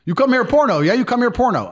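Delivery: high, loud taunting broken-English voice; mocking local thug. Moldovan man taunting in broken English.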